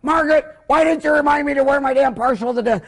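high-pitched, indignant mock yell at a wife. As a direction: imitate a husband hollering blame at his wife. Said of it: "Then he yells".

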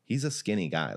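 ordinary talk

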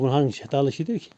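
usual speaking voice

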